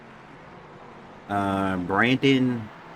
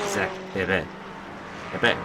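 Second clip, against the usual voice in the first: a light French accent on the name, half joking; mock French accent; 3 dB quieter, faster than usual. Shown as a demonstration. Commentator saying the driver's name like a French person. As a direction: roll it out like a French person would. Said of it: "If I say it like a French person".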